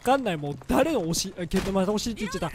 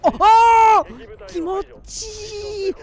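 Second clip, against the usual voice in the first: pleasured moan; 11 semitones higher, 7 dB louder, much slower than usual. Real, not acted. Moaning with pleasure in game.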